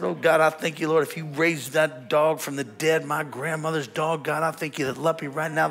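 re-enacted desperate prayer; fervent praying. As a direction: plead with God in a rolling rush. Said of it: "trying to pray".

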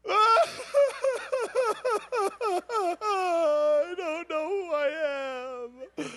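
high, broken crying voice; weeping while confessing; retold weeping. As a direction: sob it out like a lost teenager. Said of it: "I'm just, just instant weeping".

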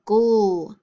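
GHOUL as a drawn-out "gul" syllable; isolated syllable sound. Shown as a demonstration. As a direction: sound out "ghoul" as the -gle ending. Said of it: "Final syllable".

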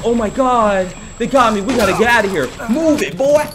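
high, urgent yelling; panicked shouting. Pretend. Gamer tells character to escape, panicked shouting. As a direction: urge him on in a panic.

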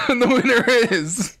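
award-show suspense; announcer reveal. Pretend. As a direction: stretch out the winner reveal. Dramatic announcement.

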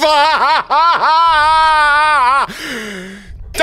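high, loud nervous laugh after a fright; shocked laughter. Real, not acted. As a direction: laugh off the scare. After jump scare.

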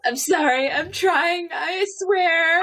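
laughing. Real, not acted. Laughing fit at table.

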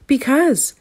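high-pitched exclamation; a storyteller's contained yell; mild yell. A narrator's reading. Gnome explaining himself to the otter, yelling mildly. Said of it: "yelled the gnome".

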